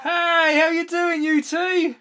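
sing-song hello to little ones. Real, not acted. Greeting kids calling in.

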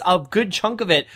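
usual speaking voice

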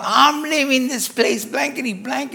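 high, slow mock outburst; retold defiant yell, tailing off; imitated angry yelling. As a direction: act out a patient cussing out the nurses. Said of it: "he's cussing them out and yelling at them".

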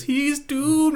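high-pitched ominous he's doomed; high doom warning. Retold or imitated. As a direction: squeak out a creepy warning. Imitating Ralph on the phone.

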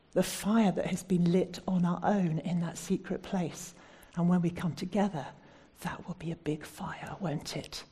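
normal talking voice

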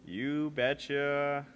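slow, drawn-out Minnesota "you betcha"; Minnesota accent. Shown as a demonstration. Signing off the podcast, slow Minnesota "you betcha". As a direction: stretch "you betcha" like a Fargo local. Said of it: "for Fargo".

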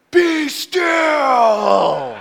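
loud, high drawn-out shout; acted-out command yelled into a gale; yelling into wind. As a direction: bellow it as if over a howling storm. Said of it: "yelling into the wind".

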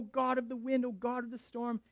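mock sailors crying to their gods; panicked pagan prayer. Pagan sailors in the storm praying, panicked and high. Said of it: "the people on the ship are freaking out".

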